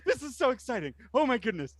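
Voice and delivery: high, laughing excited voice; giddy excitement. Acted. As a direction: bubble over with delight. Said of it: "you hear [name]'s voice".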